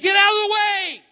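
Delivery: high, very loud angry yell; angry shout. Acted. Preacher voicing angry Jesus to his disciples, angry shout. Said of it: "He's angry. He says".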